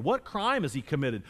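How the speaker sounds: usual speaking voice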